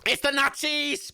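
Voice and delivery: excited cry. Real, not acted. Viewer spotting the Nazis arrive, gleeful cry. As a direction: announce the villains with glee. Villains appear on screen.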